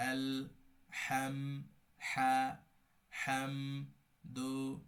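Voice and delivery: আল হাম দু split into separate syllables with pauses. Shown as a demonstration. Teacher splitting the word for learners, syllable by syllable. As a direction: break আল, হাম, দু apart, each its own beat. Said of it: "প্রত্যেকটা কেটে কেটে দিলাম".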